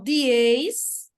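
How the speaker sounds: "dieis" emendado, devagar; sílabas juntas. Shown as a demonstration. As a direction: junte di e eis em "dieis". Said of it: "junto com a outra vogal". ("dieis" esticado, vogais unidas)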